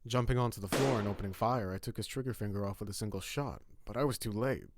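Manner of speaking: usual speaking voice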